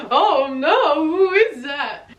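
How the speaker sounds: high, loud, drawn-out mock swoon; retold smitten reaction. Girlfriend retelling first seeing her boyfriend, smitten mock swoon. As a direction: act out being floored by a crush. Acts out first sight of crush.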